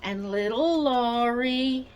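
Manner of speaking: slow, high sing-song greeting with stretched words; sing-song show intro. Real, not acted. Host welcoming viewers to her rocking-chair show, sing-song.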